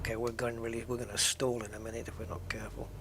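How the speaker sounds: tense under-the-breath whisper. Real, not acted. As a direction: whisper it while concentrating.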